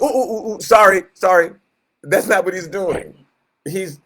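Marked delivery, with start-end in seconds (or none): 0.0-1.5 higher, fussing ooh ooh then sorry sorry; flustered mock apology. God not scrambling to apologize, mock flustered sorry. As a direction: play a flustered apologizer, hammed-up. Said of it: "God's not going".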